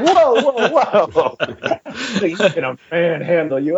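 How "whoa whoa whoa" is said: mock startled whoa. Taken aback by rival's taunt.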